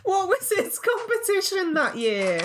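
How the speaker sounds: high voice breaking with laughter; helpless laughter. Real, not acted. Reacting to the Academy Award nomination, helpless incredulous laughter. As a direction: laugh through the question, incredulous.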